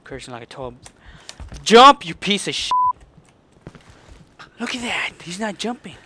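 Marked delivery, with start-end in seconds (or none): 1.6-5.9 very loud, high, spitting rage; furious scream. Real, not acted. Player screaming at a character that won't jump, furious. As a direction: yell it like the controller betrayed him. Raging at game.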